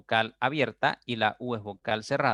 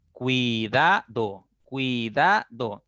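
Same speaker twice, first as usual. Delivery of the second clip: "cuidado" slowly broken into syllables, cui kept as one; 3 dB louder, much slower than usual. Shown as a demonstration. Teacher splitting a word for students, "cuidado" slowly syllable by syllable. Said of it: "Entonces diré".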